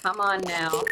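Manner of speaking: normal talking voice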